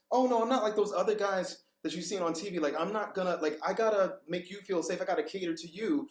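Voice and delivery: high, eager-to-reassure voice, imitating an old self. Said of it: "have to kinda pitch my voice up".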